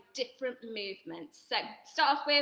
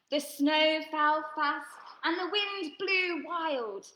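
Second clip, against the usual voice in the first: slow story narration; 3 semitones higher, slower than usual. A narrator's reading. Storytelling refrain, slowed.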